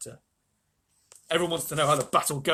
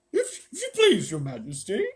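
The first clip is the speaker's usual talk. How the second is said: halting bear, high and loud at first; thick, sleepy bear voice; 3 semitones higher, 6 dB louder than usual. A narrator's reading. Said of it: "sleepy voice said".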